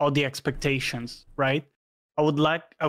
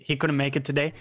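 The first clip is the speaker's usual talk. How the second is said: usual speaking voice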